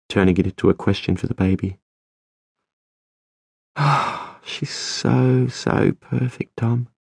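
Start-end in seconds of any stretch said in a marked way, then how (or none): 3.8-6.9 higher, hushed and softer with wonder; tender awe. A narrator's reading. Isabel marvels at the baby to Tom, high and hushed. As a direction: marvel at the child, slowing on perfect. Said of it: "she said".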